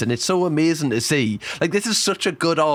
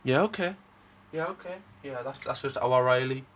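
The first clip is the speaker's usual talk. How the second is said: quiet pleased murmur; 8 dB quieter than usual. Real, not acted. Reacting to glowing report card.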